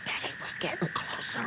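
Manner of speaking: soft hushed whisper. Acted. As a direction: whisper while sneaking close. Sneaking near guard dogs.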